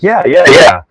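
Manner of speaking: loud, eager yeahs, imitating the old guy; eager agreement. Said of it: "he's like".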